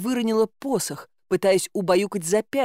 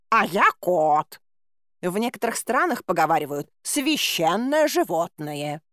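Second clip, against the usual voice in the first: a smug tomcat stating his rank; a hint of a haughty cat; proud cat. A narrator's reading. Cat boasting of himself.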